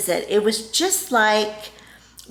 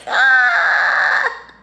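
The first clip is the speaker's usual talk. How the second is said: loud drawn-out cry; hammed-up loud wail of dismay; mock horrified scream; 4 semitones higher, 6 dB louder than usual. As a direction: cry out as if appalled at yourself. Acts horror at own blurt.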